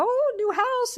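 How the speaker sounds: high-pitched mock gushing over a house; excited squeal imitation. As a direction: imitate an overexcited woman, voice way up high. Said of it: "Blanche is very excited".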